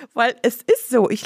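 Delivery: laughing through the words, high and loud. Real, not acted. Still laughing after the story at the counter. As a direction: keep the laugh going as you talk.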